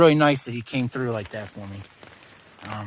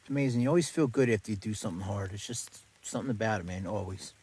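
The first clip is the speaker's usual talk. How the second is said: ordinary delivery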